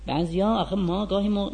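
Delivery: usual speaking voice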